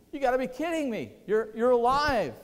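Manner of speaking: loud, high burst of astonished joy; can't believe it, pitch leaping up; amazed disbelief. Acted. Women finding Jesus alive, amazed disbelief.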